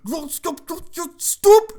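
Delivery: very high, strangled mock shouting; hammed-up yelling to halt someone; imitated frantic yell. Boss ordering Dutch to stop, imitated frantic yell.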